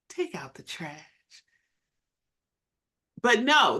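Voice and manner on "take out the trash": very soft, low and hushed; mock soft request. Shown as a demonstration. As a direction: demonstrate an unnaturally quiet way of asking. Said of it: "that's not how it's gonna come out".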